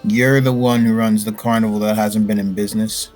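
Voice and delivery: ordinary talk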